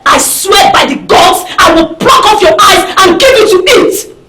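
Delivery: outraged warning, forcefully spaced out; angry, emphatic vow; furious threat. Acted. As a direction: warn them off with real menace. Threatens man after he grabs her.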